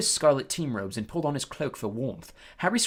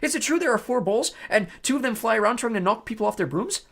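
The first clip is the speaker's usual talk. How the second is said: put-on eager boy voice; 9 semitones higher, 6 dB louder than usual. Colin eager questions.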